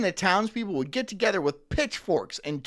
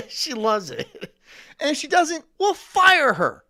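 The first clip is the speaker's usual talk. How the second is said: higher, much louder, slow; hammed-up boss declaration; mock sleazy boss; 8 semitones higher, 8 dB louder than usual. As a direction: ham up a crude old boss. Mocking a sleazy old-school boss.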